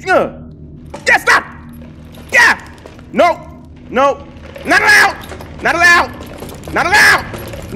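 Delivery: high, short yelled commands; panicked scolding shouts. Real, not acted. Player telling figures they're not allowed, panicked shouts. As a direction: shout them back in alarm. Ordering game enemies to stop.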